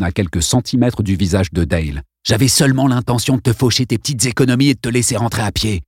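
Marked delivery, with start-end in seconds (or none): 2.3-5.8 mustache twirling villain. Acted. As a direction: rush the threat, then sneer. Congdon threatening Dale with knife.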